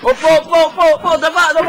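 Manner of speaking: panicked compliance. Acted. Frightened victim obeying.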